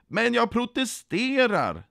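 high, slow, drawn-out objection; indignant protest. A narrator's reading. Nekad protesterar till kontrollanten, indignant. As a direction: stretch out the protest. Refused applicant protests to inspector.

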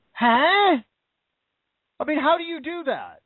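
loud, high incredulous huh. Real, not acted. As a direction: blurt it out in loud disbelief. Incredulous reaction to hypocrisy claim.

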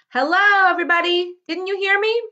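loud, high call across the orchard; hollering to the trees. A narrator's reading. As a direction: holler it out so every tree hears. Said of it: "another chance to reply and hollered".

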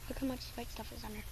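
hushed whisper, no voice. Real, not acted. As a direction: breathe the words out quietly. Whispering about candy coating.

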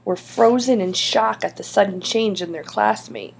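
ordinary delivery

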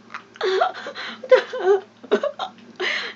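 high, loud squealing laugh, rising; cracking up at a lucky shot; shrieking laugh. Real, not acted. Gamer squeals with laughter at his own shot. Replay of funny kill.

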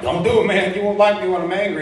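hammed-up Hulk-style warning; mock tough warning. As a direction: warn him off like a tough guy. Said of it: "I'm like".

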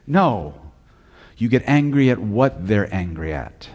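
ordinary delivery

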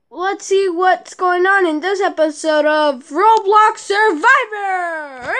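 drawn-out shout on the show title; announcer hype. Acted. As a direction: build up to a long, stretched show title. Show host announces episode title.

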